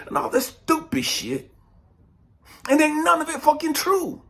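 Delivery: ordinary talk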